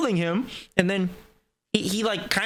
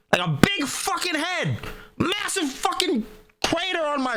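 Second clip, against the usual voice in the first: amazed exaggeration; 9 semitones higher than usual. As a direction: stretch it out, pitched up. Describing huge head on him.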